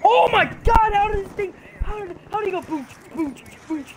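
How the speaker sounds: high, loud fast disbelief; shocked rant. Real, not acted. Gamer stunned by an explosion kill, high shocked rant. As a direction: spill it out in real shock.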